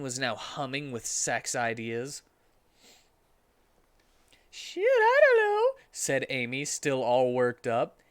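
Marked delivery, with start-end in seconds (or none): 4.5-5.8 put-on squeaky high girl voice, louder; high Amy voice. Amy tells Sonic about Shadow, squeaky high voice. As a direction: pitch way up as Amy, shaken.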